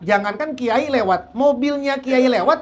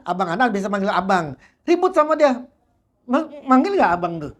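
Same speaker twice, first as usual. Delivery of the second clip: ordinary talk, nothing marked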